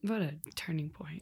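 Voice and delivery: breathy hushed aside; whisper. Real, not acted. Friend whispers a mock-solemn aside about whiskey, whispering. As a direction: whisper it like a secret.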